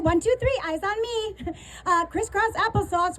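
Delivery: soft singsong classroom call; kindergarten teacher voice. Shown as a demonstration. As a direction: settle the little ones down, teacher-style. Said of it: "I'm gonna be a little teacherish".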